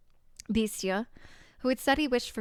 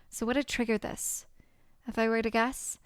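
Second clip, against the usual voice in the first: usual speaking voice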